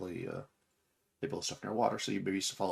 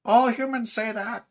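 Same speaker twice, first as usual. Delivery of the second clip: slow, loud, very high creature voice; high-pitched tree creature; 13 semitones higher, 9 dB louder, slower than usual. Acted. Tree creature answers the travellers' plea, high-pitched. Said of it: "I can do the voice".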